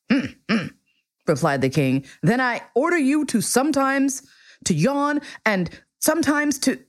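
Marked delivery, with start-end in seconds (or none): 0.1-0.7 vexed little hums; vexed king. A narrator's reading. King replying to the abashed prince, vexed. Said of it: "he spluttered a little and seemed vexed". 2.2-6.8 higher-pitched flustered royal order, breaking off unfinished; spluttering, vexed. A narrator's reading. King fumbling a new order to the prince, vexed. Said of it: "he spluttered a little and seemed vexed".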